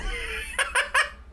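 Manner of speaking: sudden high-pitched laugh; high burst of laughter. Real, not acted. Laughing after grabbing the wrong drink. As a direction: crack up at her own blunder.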